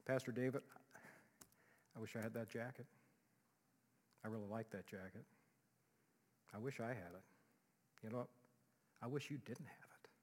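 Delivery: quiet covetous voice, sinking to a whisper; envious murmur. Acted. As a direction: murmur it with envy, ending in a whisper.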